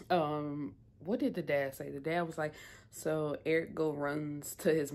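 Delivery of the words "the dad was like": usual speaking voice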